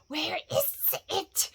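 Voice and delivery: slow, high, hissing whisper; drawn-out, whispery creature whine; Gollum crying out. A narrator's reading. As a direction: whimper it in rising panic. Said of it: "Bilbo heard him crying".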